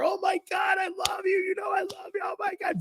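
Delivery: gushing in a very high, soft put-on voice; Virgil Hill. Boxer hugs his friend at ringside, gushing high-pitched impression. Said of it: "Virgil Hill comes over".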